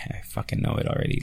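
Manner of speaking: hushed, fast, unvoiced whisper. Real, not acted. Podcaster dreading his partner's goodbye, whispering fast. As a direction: mutter it under your breath, quick.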